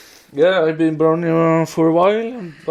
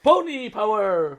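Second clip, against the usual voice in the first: hero cry; 6 semitones higher than usual. Acted. Reading show quotes aloud.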